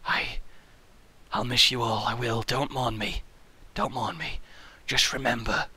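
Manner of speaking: hammed-up frail farewell; feeble dying voice. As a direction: fade out like an old man dying. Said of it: "I'm like".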